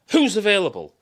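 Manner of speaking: loud, high call for takers. Retold or imitated. Studio exec calling for a free director, loud and high. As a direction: shout it out to the room. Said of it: "they go fishing".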